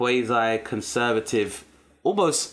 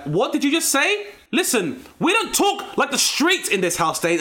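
indignant mum; slower than usual. Retold or imitated. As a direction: play the outraged aunt, pitched way up. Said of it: "My mum and my aunts would be like".

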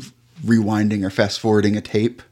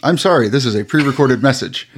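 robotic recorded message; 4 semitones higher, 5 dB louder, faster than usual. Acted.

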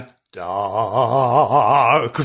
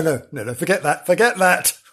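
ordinary talk, nothing marked

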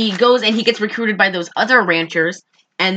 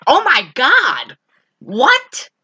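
shocked disbelief; 4 semitones higher, much slower than usual. Retold or imitated. Retelling her reaction to the film, high shocked disbelief. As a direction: react like you can't believe what you just heard. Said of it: "I was like".

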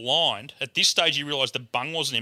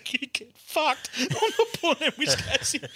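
high, laughing refusal; acted indignant refusal; 10 semitones higher, slower than usual. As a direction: retell your refusal, pitched right up through laughter. Acts his refusal to Bert, laughing.

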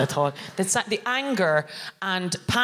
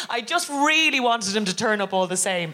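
ordinary delivery

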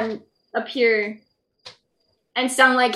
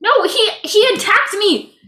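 higher-pitched denial; indignant protest; 7 semitones higher, 4 dB louder than usual. Acted. Impostor claims he was attacked, indignant protest. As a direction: protest innocence urgently.

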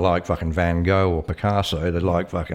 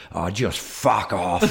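slow dismissive retort; much slower than usual. Retold or imitated. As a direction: drag out "fuck off" slowly, fed up. Telling kangaroos to leave.

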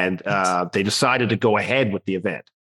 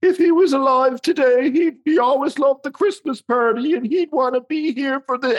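very high, hammed-up weepy voice; imitating tearful widow. Widow addressing the Christmas party, mock tearful. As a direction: put on a high crying voice. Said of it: "she's crying".

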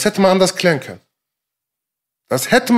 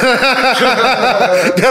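real hearty laugh; 5 semitones higher, 3 dB louder than usual. Real, not acted. Host cracks up at a guest's crude quote, hearty laugh. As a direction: burst of high, loud laughter.

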